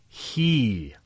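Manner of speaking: a single emphatic "He", hanging in the air; stress on HE. Shown as a demonstration. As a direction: stretch "He" out with weight. Rereads verse, isolating the pronoun.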